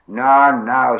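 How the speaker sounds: loud, firm interruption; firm reproof. Acted. Sam stops Gollum clawing at rabbit, firm reproof. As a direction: cut in to restrain him.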